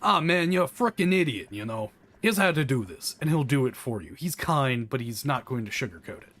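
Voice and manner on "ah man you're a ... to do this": lightly voiced mob boss, raised and loud; mock mob boss. Mob boss tells you off while helping, lightly mimicked bluster. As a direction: scold like an exasperated but helpful mobster. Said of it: "you'll get a mob boss who tells you".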